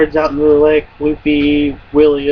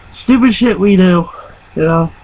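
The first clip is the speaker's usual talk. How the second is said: normal talking voice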